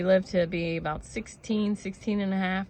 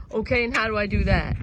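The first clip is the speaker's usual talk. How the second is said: retold sobbing plea; 6 dB louder than usual. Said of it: "Keep in mind, I'm sobbing at the time".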